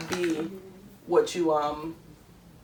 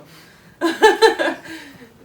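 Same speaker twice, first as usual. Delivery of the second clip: loud laugh; 13 semitones higher, 11 dB louder than usual. Real, not acted. Big laugh after joking.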